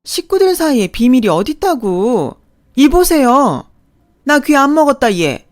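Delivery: loud, high scolding mother-in-law; indignant retort. Acted. Mother-in-law scolds her daughter-in-law for her tone, indignant. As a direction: answer back loudly, brazen and offended.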